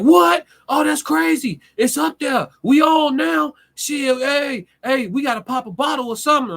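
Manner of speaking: mock friend screaming with hype over the news; hyped friend. Friend on the phone hearing the Netflix news, screaming excitement. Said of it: "Motherfucker on the phone like".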